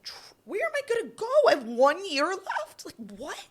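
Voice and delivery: high, fast, rising panic; panicked disbelief. Retold or imitated. Cut player asking coaches where she can go, panicked. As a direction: voice jumps up in shock and fear. Just told she's cut.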